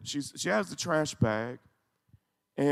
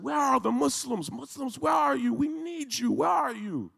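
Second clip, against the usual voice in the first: much higher, anguished calling out; desperate plea; 9 semitones higher than usual. Retold or imitated. Grandmother begs Muslims on camera, high and desperate. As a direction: cry out to them, begging for help. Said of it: "And she's screaming".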